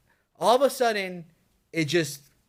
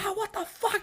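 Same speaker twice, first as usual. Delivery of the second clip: high quieter cry, mock panic; mock scream; 14 semitones higher, 4 dB quieter than usual. Retelling sleep talking to friends, a high panicked cry. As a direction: squeal it high as if terrified. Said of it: "I was talking".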